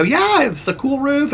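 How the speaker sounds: high, slow goofy voice; lightly silly high drawl; mock casual reply. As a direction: play yourself as clueless. His own reply, mocked.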